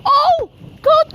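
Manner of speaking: startled cry. Real, not acted. Lightning strike right in front.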